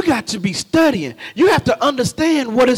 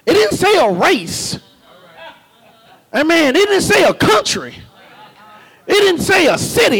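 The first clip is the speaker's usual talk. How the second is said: full-force preaching; 5 semitones higher than usual. Acted. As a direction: preach it out, rising and calling to the congregation.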